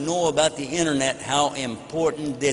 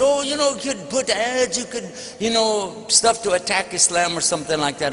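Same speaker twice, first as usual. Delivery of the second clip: mock salesman; 6 semitones higher than usual. Salesman's pitch, quoted.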